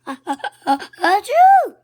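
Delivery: building gasps ending in a huge high sneeze; echoed sneeze. A narrator's reading. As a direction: copy the sneeze back, big and loud. Fans echo the sneeze.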